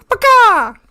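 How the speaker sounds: loud, high-pitched hen squawks; chicken squawk. Retold or imitated. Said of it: "There was a sound of a".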